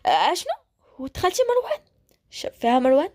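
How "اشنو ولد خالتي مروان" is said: shocked yell. Acted. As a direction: pitch jumps way up in disbelief. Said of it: "وغوتت".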